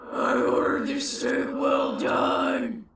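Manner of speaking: slow, drawn-out ghostly shout; scary ghost voice. Shown as a demonstration. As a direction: try to scare a waiter. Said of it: "Good first effort".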